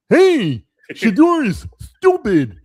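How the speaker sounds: high, loud goofy moose voice; Bullwinkle voice. Pretend. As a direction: play it dumb like a cartoon moose. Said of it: "certain Bullwinkle things you would say".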